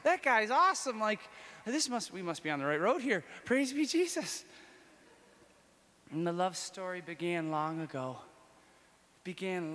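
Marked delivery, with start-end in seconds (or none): 0.0-4.4 high, loud gushing delight; thrilled. Retold or imitated. Praising Jesus after reading Fulton Sheen, thrilled. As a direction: gush about it in awe. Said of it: "I was like".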